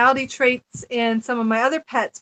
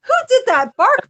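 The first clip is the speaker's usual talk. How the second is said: surprised; 10 semitones higher, 4 dB louder than usual. Retold or imitated. Startled by the strange bark.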